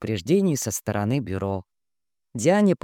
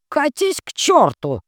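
loud, high angry shout; angry outburst; 13 semitones higher, 5 dB louder than usual. Acted. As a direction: lose temper and yell. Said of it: "не выдержал Лэнгдон".

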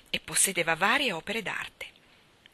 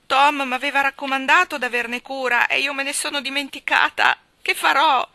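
much higher and louder, tearful; tearful outburst; 8 semitones higher, 9 dB louder than usual. Acted. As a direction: wail it through tears. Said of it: "grosse lacrime le cadevano giù per le gote". (Maggie in lacrime grida disperata al contadino)

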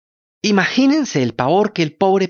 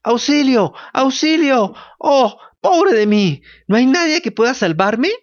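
wailing for help; 10 semitones higher than usual. A narrator's reading. Pinocchio trapped in the shark, wailing for help. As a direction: wail for help, terrified. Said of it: "gimiendo decía".

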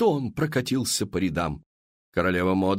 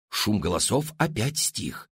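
ordinary delivery

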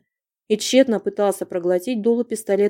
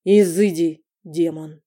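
icy voice; 4 semitones lower, slower than usual. A narrator's reading. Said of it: "ледяным голосом произнёс".

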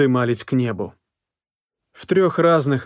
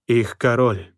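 ordinary delivery, nothing marked

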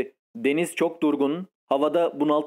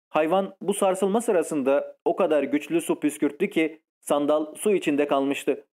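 ordinary delivery

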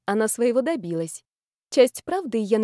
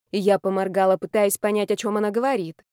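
ordinary delivery

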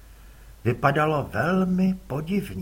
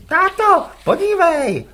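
put-on much higher, louder boy's call; a boy urging his dad to look; 10 dB louder than usual. Rival's son calls his father, high boy's voice.

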